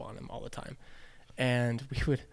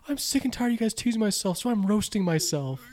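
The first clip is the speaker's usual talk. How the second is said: put-on higher, pouty little kid voice; sulky little brother; 9 semitones higher than usual. Little brother tells the teasing teen he's roasting himself, sulky and higher. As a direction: play the hurt little brother, a bit higher.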